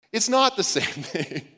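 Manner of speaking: laughing through the words. Real, not acted. Preacher laughing at the joke he just told. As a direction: chuckle it out, slowed. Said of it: "laughing at the punchline".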